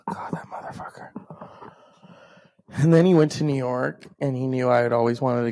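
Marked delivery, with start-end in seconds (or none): 0.0-1.1 whispered, exasperated swearing; very soft, voiceless aside after a sigh; muttered curse. Real, not acted. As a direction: curse him under your breath.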